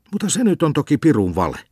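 put-on the boy objects wide awake; indignant protest. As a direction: protest in shock.

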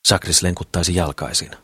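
ordinary delivery, nothing marked

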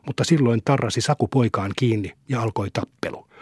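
ordinary delivery, nothing marked

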